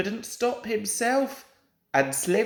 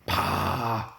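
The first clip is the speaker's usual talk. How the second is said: scornful pah; 9 semitones lower than usual. A narrator's reading. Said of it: "said Gordon crossly".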